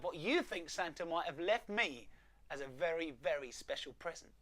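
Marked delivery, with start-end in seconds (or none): none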